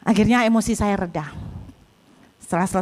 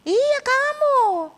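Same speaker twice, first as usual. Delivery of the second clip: high, very slow emphatic reply; acted high, long-drawn insistence; drawn-out confirmation; 12 semitones higher, much slower than usual. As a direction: stretch it out to a bewildered pupil. Teacher confirms to bewildered pupil.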